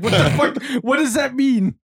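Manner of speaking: high, loud confused shout; mock shout of confusion from a returning kid; startled kid yell. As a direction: burst out baffled, like a kid back from another world. Kid returning from Narnia voiced.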